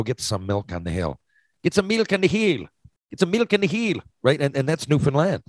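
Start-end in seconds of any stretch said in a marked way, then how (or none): 1.6-2.7 high, lilting Southern Shore Newfoundland accent. Shown as a demonstration. Showing how Southern Shore Newfoundlanders ask for milk, in a high, lilting local accent. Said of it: "At some points of the Southern Shore". 3.1-4.0 high, lilting Southern Shore Newfoundland accent. Shown as a demonstration. Showing how Southern Shore Newfoundlanders ask for milk, in a high, lilting local accent. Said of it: "At some points of the Southern Shore".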